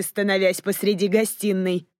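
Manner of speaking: plain reading voice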